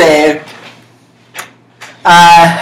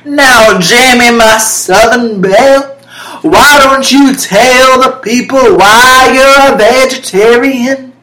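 sassy southern host asking her co-host; lightly hammed southern host, a bit higher; Paula Dean voice; 8 semitones higher, 5 dB louder than usual. Host quizzing the vegetarian co-host, mild southern sass. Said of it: "Paula Dean".

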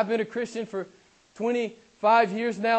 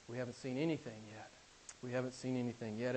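very soft, low and quick; hushed awe; 8 semitones lower, 16 dB quieter, faster than usual. Real, not acted. Preacher marveling at God's hidden power, hushed and awed. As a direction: drop to an awed undertone.

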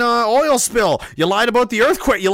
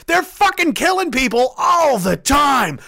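furious shout; 6 semitones higher, slower than usual. Real, not acted. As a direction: yell it out in slow outrage. Angry rant about killings.

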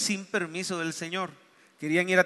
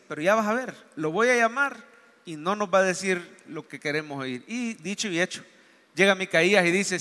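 usual speaking voice

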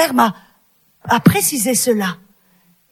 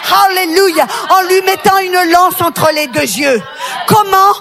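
rally the women like a preacher; fervent preaching; 3 semitones higher than usual. Acted. Exhorts the women, full preaching.

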